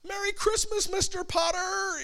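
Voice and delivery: high mock Jimmy Stewart yell; mock yelling greeting from the film; imitating a yell. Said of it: "Everything is a yell".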